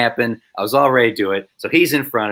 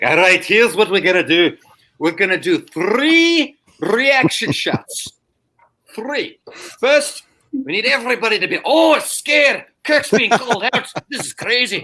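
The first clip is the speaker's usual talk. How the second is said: put-on Scottish accent; 12 semitones higher than usual. Scottish AD directing extras for reaction shots, excited accented orders. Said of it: "should I try a Scottish accent?".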